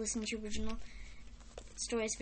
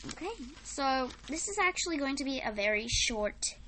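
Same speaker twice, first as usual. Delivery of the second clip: usual speaking voice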